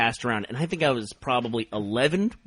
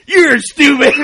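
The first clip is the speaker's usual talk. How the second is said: louder, higher laughing jab; laughing shout; 11 semitones higher, 12 dB louder, much slower than usual. Retold or imitated. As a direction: yell it out while laughing. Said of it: "He goes".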